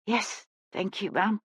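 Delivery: very soft, faltering reply; timid, quiet thanks after a scare; small, shaky voice. Acted. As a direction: answer small and shaken, barely voiced. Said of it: "in a small, shaky voice".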